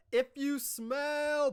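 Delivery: high, drawn-out "if you smell" catchphrase; mock Rock catchphrase cut short.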